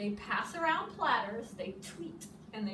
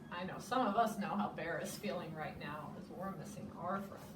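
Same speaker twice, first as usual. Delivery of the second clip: ordinary delivery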